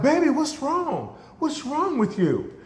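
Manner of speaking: high-pitched worried mother voice; concerned mother. Retold or imitated. Mother to crying daughter on Christmas morning, imitated high concern. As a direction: play a mom fretting over her child.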